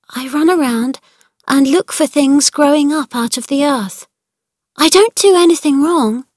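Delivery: put-on rising, anxious little girl's voice; anxious pleading. Mary insisting to her uncle she does nothing wrong, anxious. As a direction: defend yourself, worried he'll scold.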